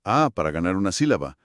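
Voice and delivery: normal talking voice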